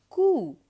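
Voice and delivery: "cou" as a loud, high koo; same oo sound. Shown as a demonstration. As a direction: model "cou" with a clear oo vowel. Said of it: "it's the exact same sound".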